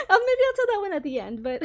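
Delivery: laughing while saying it. Real, not acted. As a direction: talk through a fit of giggles.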